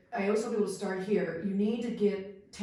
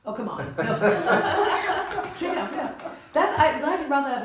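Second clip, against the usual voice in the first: laughing; 7 semitones higher, 8 dB louder than usual. Real, not acted. Laughing at friend's story.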